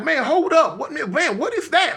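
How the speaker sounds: high, confused alarm; panicked what is that; high startled questioning. Retold or imitated. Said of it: "I'm like".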